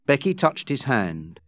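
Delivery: plain reading voice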